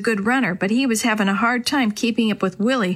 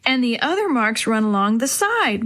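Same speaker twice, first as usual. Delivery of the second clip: plain reading voice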